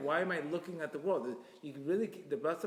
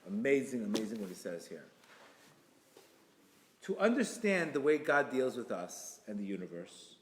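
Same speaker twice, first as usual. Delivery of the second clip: normal talking voice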